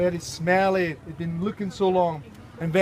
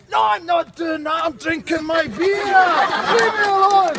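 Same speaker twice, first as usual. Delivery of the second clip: higher, louder protest that builds to a shout; stubborn husband; 8 semitones higher, 5 dB louder than usual. Acted. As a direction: refuse her, getting louder. Husband refusing wife, drunk.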